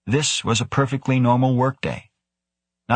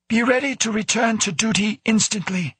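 high-pitched, stern director character voice; director's orders; 14 semitones higher than usual. A narrator's reading. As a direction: issue a standing order in a much higher voice. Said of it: "the director added".

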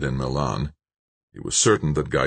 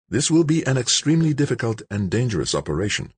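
higher, lightly voiced character caution; formal, warning Nobo; 8 semitones higher, faster than usual. A narrator's reading. Nobo warning George off the operation, formal caution. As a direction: warn him in a higher character voice.

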